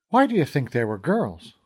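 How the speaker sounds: usual speaking voice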